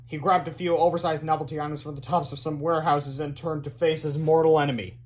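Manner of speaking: usual speaking voice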